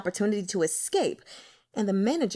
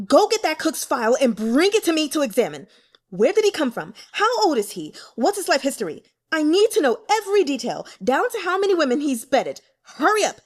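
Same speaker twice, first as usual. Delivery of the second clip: angry orders; 6 semitones higher, 7 dB louder than usual. A narrator's reading. Lord barking orders in fury.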